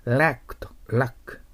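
first ラック with æ, second with short back "a"; lack versus luck. Shown as a demonstration. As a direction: contrast the two ラック vowels. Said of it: "気をつけてください".

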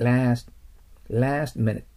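last minute as "las' mini'"; English last minute, final t unreleased; t nearly silent. Shown as a demonstration. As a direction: swallow the t's in last minute. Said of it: "ほとんどTは聞こえない".

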